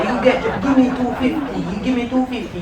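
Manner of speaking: hawking vendor pushing the price; pushy vendor. Acted. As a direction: press the price like a market seller. Haggling vendor in patois.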